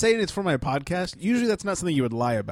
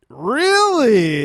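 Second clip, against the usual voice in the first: disbelieving; 7 semitones higher, 8 dB louder than usual. Retold or imitated. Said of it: "she's like".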